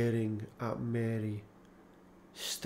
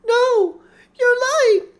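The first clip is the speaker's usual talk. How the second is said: high, loud cry of denial; desperate denial; 16 dB louder, slower than usual. A narrator's reading. Laura refuses James's news, high and loud. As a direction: refuse to believe it, crying out.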